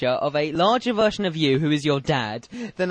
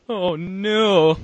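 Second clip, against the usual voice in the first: loud demo of a buzz-in; loud mock buzz-in cry; shouted buzzer word; 3 semitones higher, 7 dB louder than usual. Shown as a demonstration. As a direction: call it out like a contestant buzzing in. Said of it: "you were shouting".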